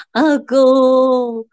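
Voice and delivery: loud, high ritual wailing call; mock mourning wail. (假装哭喊)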